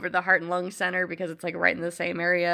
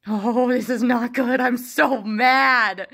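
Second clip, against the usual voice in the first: loud, slow, exaggerated fuming; hamming up the fury at an empty building; mock angry outburst; 4 semitones higher, 8 dB louder, much slower than usual. Alone at the closed lab, a loud mock-furious outburst.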